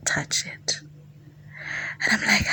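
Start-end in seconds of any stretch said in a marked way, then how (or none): 0.1-0.8 hushed breathy command; low secret whisper. Retold or imitated. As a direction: whisper it like a seductive order. His whispered command on phone.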